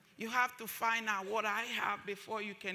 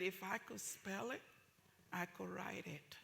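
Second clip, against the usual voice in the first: softer, measured retort; calm quiet comeback; 3 semitones lower, 11 dB quieter than usual. Retold or imitated. Mother answering the receptionist, softer and measured. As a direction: say it low and deliberate after a deep breath. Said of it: "I just took a deep breath and I said".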